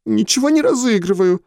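put-on high, hurt little girl near tears; sniffling. A narrator's reading. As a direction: sniff and protest. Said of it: "шмыгнула носом Таша". (шмыгая носом, обиженно)

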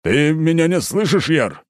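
exasperated. A narrator's reading. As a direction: plead with someone who will not listen.

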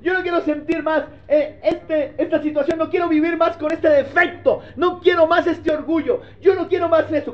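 desperate seeker. Retold or imitated. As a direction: beg God to show what to do. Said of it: "donde pregunta".

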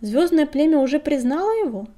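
lightly voiced insistent old crone; persistent old woman. A narrator's reading. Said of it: "не унималась старуха". (настойчивый старушечий расспрос, слегка)

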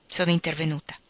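usual speaking voice

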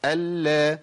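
ال with a held L opening into "la"; doubled lam with fatha. Shown as a demonstration. Teacher shows the same timing, held L released to a. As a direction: give ال the same L timing, then a. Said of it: "نفس الزمن".

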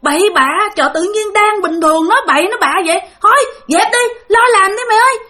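high, loud scolding that climbs to a yell; scolding shout. Retold or imitated. Coworkers shout at the mason for his careless talk, a rising scolding yell. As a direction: tell him off sharply and shout him back to work. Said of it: "Cái mấy anh đó mới la".

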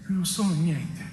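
normal talking voice

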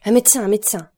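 narrated cry of alarm. A narrator's reading. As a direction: call out urgently for a doctor. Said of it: "cria Villefort".

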